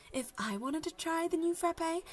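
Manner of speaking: ordinary talk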